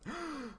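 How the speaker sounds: sharp theatrical gasp; mock gasp. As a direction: gasp in fake shock. Mock shock at name.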